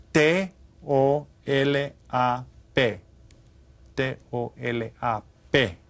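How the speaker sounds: T O L A P spaced out slowly; slow spelled letters. Shown as a demonstration. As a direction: dictate each letter so they can write it. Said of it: "jot these down".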